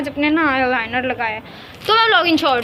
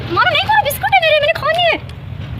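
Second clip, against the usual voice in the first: squealed pleading, stretched at the end; high-pitched protest; 12 semitones higher than usual. Real, not acted. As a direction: protest over the biscuits in a high voice. Protesting to pet over biscuits. (ऊँची आवाज़ में शिकायत करते हुए)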